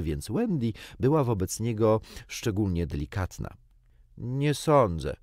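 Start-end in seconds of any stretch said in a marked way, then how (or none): none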